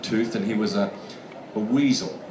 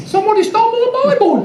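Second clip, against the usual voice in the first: very high, loud mock-Australian wail; hammed-up bad Australian accent, cried out; Meryl Streep; 8 dB louder than usual. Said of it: "poor old Meryl Streep couldn't get it".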